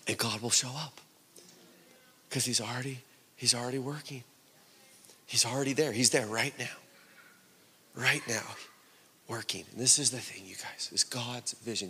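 quiet assurance to a congregation, with long pauses; hushed preaching. Real, not acted. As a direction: drop down soft and let each short phrase settle. Hushed, low close of sermon.

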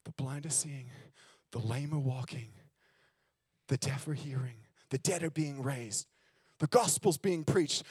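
quiet solemn quote; reverent quote. Retold or imitated. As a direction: speak it low and tender. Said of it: "and he said".

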